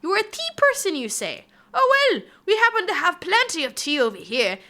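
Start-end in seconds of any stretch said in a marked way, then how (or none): 1.7-4.6 a high, loud, mock posh British welcome; hammed-up British host. To chat, offering tea in a hammed-up British voice.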